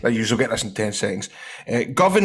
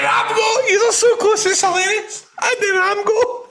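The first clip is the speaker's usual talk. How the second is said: high squealing laughter-shout; laughing shout; 4 dB louder than usual. Real, not acted. Host cracking up at a wrong guess, squealing. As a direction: shriek the name through laughter. Hooting over funny wrong answer.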